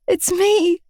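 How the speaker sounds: high, tearful cry. Acted. Girlfriend crying to her dazed boyfriend, tearful. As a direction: cry out to reach him. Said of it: "I cried".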